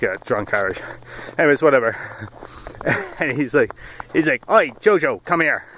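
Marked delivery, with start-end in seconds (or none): 4.5-5.6 high, loud Aussie call; mock Aussie accent. Aussie owner calling his dog back, mock Aussie accent. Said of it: "Is that Aussie accent?".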